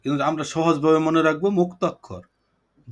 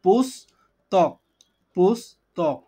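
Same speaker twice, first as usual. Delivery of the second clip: "পুস্তক" as higher পুস, pause, তক; slow syllable breakdown; 4 semitones higher, much slower than usual. Shown as a demonstration. Teacher showing two parts make the word, "পুস্তক" slowly in two pieces. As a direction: stretch "পুস্তক" into two slow separate halves. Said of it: "দুইটা ভাঙা অংশ মিলে পুস্তক".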